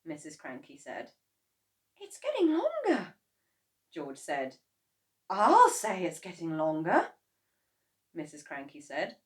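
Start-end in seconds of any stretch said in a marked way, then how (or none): 2.0-3.1 put-on high, wondering boy; boy's voice. A narrator's reading. George observing. 5.3-7.1 dry, louder sarcastic retort. A narrator's reading. Mrs Kranky sarcastic.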